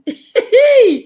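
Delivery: loud, high-pitched burst of laughter. Real, not acted. Speaker amused at her own yelling, loud laugh.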